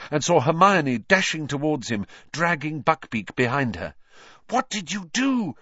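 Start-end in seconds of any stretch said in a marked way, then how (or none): 4.5-5.6 high-pitched fierce demand; fierce, accusing question, pitched well up. A narrator's reading. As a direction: demand an answer in a high, urgent voice. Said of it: "she said fiercely".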